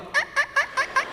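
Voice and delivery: very high, thin squeaks; imitated fan squeak. Storyteller imitating the ceiling fan's noise, high squeaks. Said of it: "the whole fan was going".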